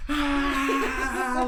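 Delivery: loud, high, sustained mock scream; cousins' panicked shriek, acted out; imitated screaming. Cousins in the back seat still going, loud mock scream. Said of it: "like, screaming still".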